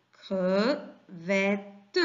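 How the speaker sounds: "crevette" in three detached syllables, cre, vet, te. Shown as a demonstration. Teacher repeating the split for pupils, "crevette" in three separate syllables. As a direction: pause after each part of "crevette", voicing the final te. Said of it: "on les sépare ainsi".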